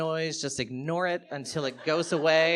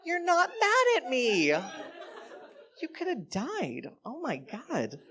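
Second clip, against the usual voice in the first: high, slow, wide-eyed disbelief; mock starstruck gush; 12 semitones higher, much slower than usual. Comic voicing a giddy fan, high and gushing.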